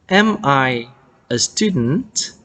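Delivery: "Am I a student?" slowly, with the pitch climbing at the end; rising question intonation. Shown as a demonstration. Teacher models an English question for learners, rising intonation. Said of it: "rising intonation".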